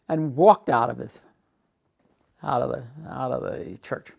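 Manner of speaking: ordinary delivery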